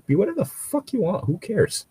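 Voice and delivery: usual speaking voice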